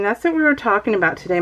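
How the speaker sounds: usual speaking voice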